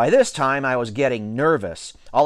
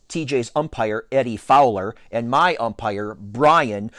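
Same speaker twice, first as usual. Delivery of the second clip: usual speaking voice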